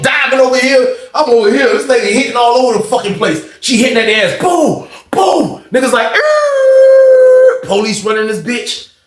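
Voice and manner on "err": very high, loud yelp of pain. Retold or imitated. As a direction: cry out like you just got struck. Inmates reacting to being hit.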